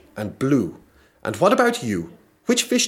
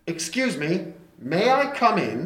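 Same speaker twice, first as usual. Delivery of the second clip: magician voice; 6 semitones higher, 4 dB quieter than usual. Acted. Magician voice at the door.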